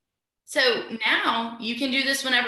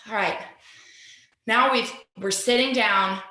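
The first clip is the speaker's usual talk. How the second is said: ordinary talk, nothing marked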